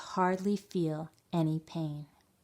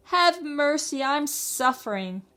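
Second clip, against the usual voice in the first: high, louder begging wail; 10 semitones higher, 4 dB louder than usual. A narrator's reading. Suffering fox begging the dentist, wailing. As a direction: plead for mercy in pain. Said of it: "wailed the fox".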